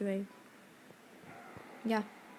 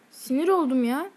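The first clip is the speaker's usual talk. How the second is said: ordinary talk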